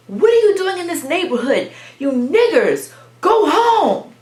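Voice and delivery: put-on high, loud yelling at children; imitated hostile yell. Neighbor ordering children out of the neighborhood, imitated hostile yell. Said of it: "So she says it again".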